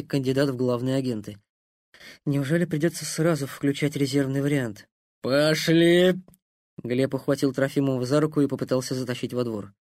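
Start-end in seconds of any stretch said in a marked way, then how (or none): 5.2-6.1 put-on slurred drunken call; tipsy soldier pulling him in; drunk rambling toast. Drunk Gleb dragging him.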